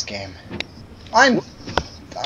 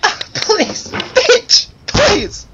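strained high plea; 12 semitones higher, much slower than usual. Real, not acted. Desperate pleading with the game.

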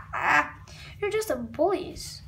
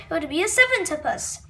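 plain reading voice

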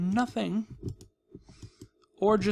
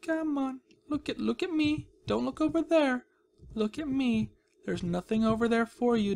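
higher, sugary coaxing voice; sweet coaxing; 5 semitones higher than usual. Acted. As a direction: lure it in gently like a pet. Coaxing villager past the cage.

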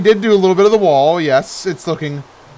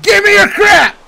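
much higher mock robber yell; a hammed-up squeaky thief demanding; robber shout; 4 dB louder than usual. Playing a robber bursting through walls, high mock yell.

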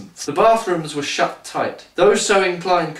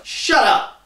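sharp, high shut up; bitter snap; 8 semitones higher than usual. A narrator's reading. Bitter woman across the aisle shuts the old man up, sharp and high. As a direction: cut him off with a high bark. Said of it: "said a bitter woman".

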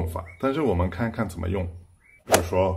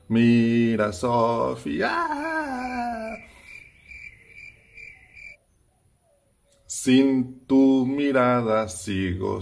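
slow lyric read; slower than usual. Shown as a demonstration. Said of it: "recites last lyric line".

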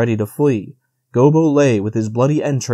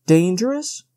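higher, mocking rise on Dangerous; incredulous; 7 semitones higher than usual. A narrator's reading. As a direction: scoff at the word Dangerous. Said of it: "smiling in his superior way".

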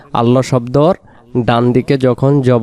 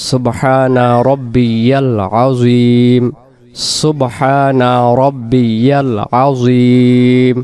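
slow, connected, drawn-out recitation; prayer recitation; much slower than usual. Shown as a demonstration. Teacher showing what is said in ruku, prayer recitation. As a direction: recite it in one breath with long-held vowels. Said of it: "নামাজে আমরা বলবো".

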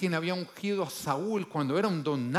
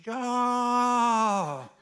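eager crowd shout; 9 semitones higher, 6 dB louder than usual. Retold or imitated. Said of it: "todos dicen".

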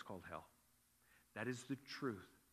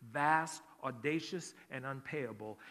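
louder, punchy pulpit delivery; forceful preaching; 5 dB louder than usual. Acted. Pastor preaching at full force.

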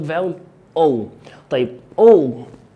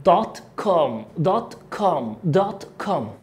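American دوت كوم, open "ah" as "daat kaam"; نطق أمريكي. Shown as a demonstration. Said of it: "شفت أنا بقولها مش دوت كوم". (لكنة أمريكية، دوت كوم بألف مفتوحة)

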